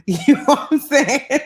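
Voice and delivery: tickled, laughing while checking they follow. Real, not acted. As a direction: let the words break up into laughter. Laughs after her own swearing.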